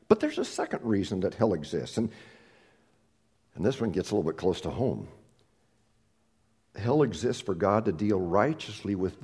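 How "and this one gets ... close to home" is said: usual speaking voice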